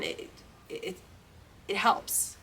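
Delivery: real choked-up gratitude, very soft; getting emotional. Real, not acted. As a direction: let your voice drop away, overcome with thankfulness. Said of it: "Sorry, I'm getting emotional right now".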